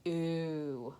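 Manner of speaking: soft drawn ooh for the U; long ooh sound. Shown as a demonstration. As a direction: say ooh clearly, like a model. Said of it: "making the U say".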